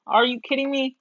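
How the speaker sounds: ordinary delivery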